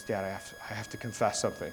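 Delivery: softly imitated nervous confession; hesitant confession. Brother owning up to dad at dinner, hesitant.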